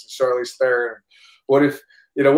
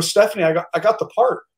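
higher-pitched, stumbling good news; retold thrilled announcement; excited announcement; 7 semitones higher than usual. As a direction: burst out with the news, pitch raised. Breaking good news to wife.

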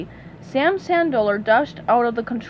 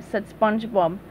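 ordinary delivery

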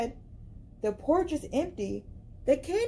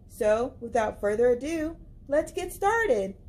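plain reading voice

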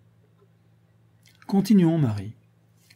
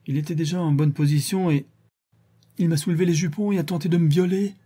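mild cry, pitched higher; a touch of a cry out, voice raised higher; 6 semitones higher than usual. Acted. Marie cries out her confession to Madeleine, a mild cry. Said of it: "cria Marie".

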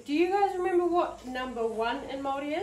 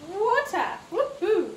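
excited cheer; 7 semitones higher than usual. Real, not acted. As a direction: celebrate the right answer. Praises child's answer.

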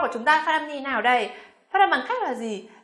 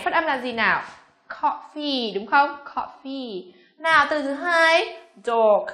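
ordinary talk, nothing marked

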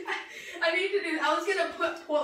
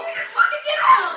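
loud laughing shout; laughing yell; 12 semitones higher, 8 dB louder than usual. Real, not acted. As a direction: shout it back through laughter. Yelling back, laughing.

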